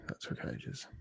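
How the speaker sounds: breathy whisper under the breath. Real, not acted. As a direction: whisper it to yourself, relieved. Relief after slow loading.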